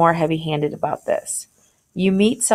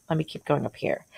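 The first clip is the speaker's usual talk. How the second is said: ordinary delivery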